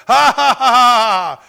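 loud, high, drawn-out "ha ha ha"; imitated defiant laugh. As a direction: laugh forcefully in the devil's face. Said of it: "Brother Hagin just laughed".

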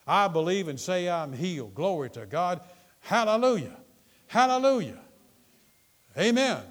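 loud, high preacher's shout; real full-force hallelujah; exultant praise. Real, not acted. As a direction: cry out praise to the congregation.

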